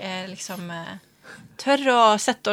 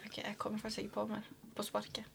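whispered aside, very soft; whispering; 22 dB quieter, faster than usual. Real, not acted. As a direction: breathe it under your breath. Muttering to herself.

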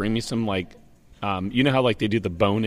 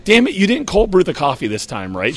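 mock yell; 6 semitones higher, 3 dB louder than usual. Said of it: "Cassie's yelling at him".

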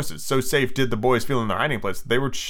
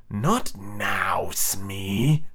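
low, dark pirate captain; low-voiced menace; 4 semitones lower, 3 dB quieter, much slower than usual. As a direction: brush off the henchman darkly. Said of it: "Hook said darkly".

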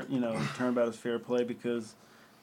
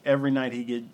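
ordinary delivery

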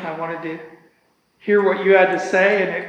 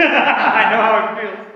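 higher, laughing through the words; real laughing; 4 semitones higher, 3 dB louder than usual. Real, not acted. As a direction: say it while cracking up. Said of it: "I'm laughing because you've just summarized".

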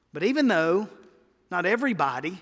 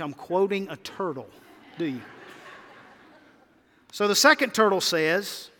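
usual speaking voice

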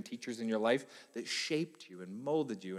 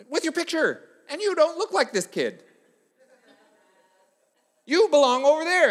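climbing higher and louder into exasperation; mock-stern sub; 7 semitones higher, 6 dB louder than usual. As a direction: build from patient to fed-up. Sub scolding seated student.

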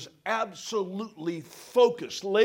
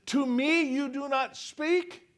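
offended; 6 semitones higher, slower than usual. Acted. Pilate's offended question.

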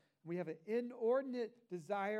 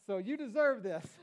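a hammed-up, louder insistence on deserving it; louder mock self-justification; 4 semitones higher, 10 dB louder than usual. Pastor mocking our excuses, louder and half laughing. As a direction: push the word deserve up with a grin. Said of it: "We say".

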